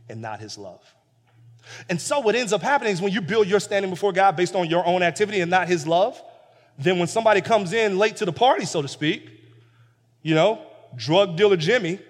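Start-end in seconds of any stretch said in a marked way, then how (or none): 1.9-12.0 much louder, rising preacher cadence; fiery sermon reading; preaching at full force. Acted. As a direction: preach the story to the congregation. Preaching climax.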